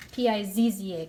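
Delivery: usual speaking voice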